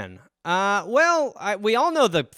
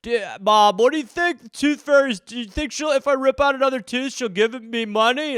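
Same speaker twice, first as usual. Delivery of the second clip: put-on higher, dopey man-child voice; overgrown child; 5 semitones higher than usual. Grown son asks mom about Tooth Fairy, naive. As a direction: wonder naively like a big kid. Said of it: "twenty-two-year-olds sitting at home".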